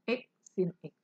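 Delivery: ordinary talk, nothing marked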